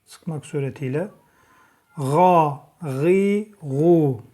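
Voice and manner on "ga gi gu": each vowel sound called out louder and distinct, E then İ then Ü; vowel drill. Shown as a demonstration. Teacher calling out vowel sounds to students, crisp and loud. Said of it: "E sesi veriyoruz".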